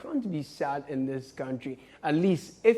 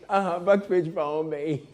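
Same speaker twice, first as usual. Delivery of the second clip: ordinary delivery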